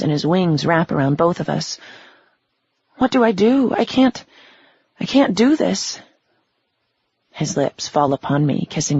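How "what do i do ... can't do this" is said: high, pleading, voice rising; panicked near tears. Acted. Melanie clinging to Nobu, high and panicked. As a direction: fall apart into frightened pleading.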